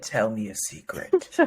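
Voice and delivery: low, slow, soft seductive bass croon. Retold or imitated. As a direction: purr it low and slow like a smooth soul crooner.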